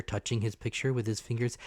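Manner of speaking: ordinary delivery, nothing marked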